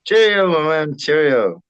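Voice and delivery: loud, high mock British Cheerio; British accent. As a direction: ham up a posh British Cheerio on request. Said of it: "in your best British accent".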